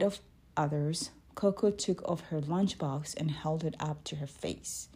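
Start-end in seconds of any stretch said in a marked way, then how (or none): none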